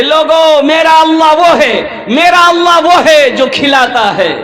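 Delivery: loud sermon delivery from the pulpit; preaching. Retold or imitated. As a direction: proclaim it to the whole mosque. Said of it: "मस्जिद में तकरीर कर रहे थे". (ज़ोरदार तकरीर का अंदाज़)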